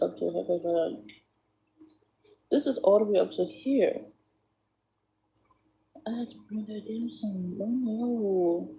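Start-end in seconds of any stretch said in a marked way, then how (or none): 6.1-8.7 whispered aside to herself; soft whispered self-talk. Real, not acted. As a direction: whisper it while concentrating.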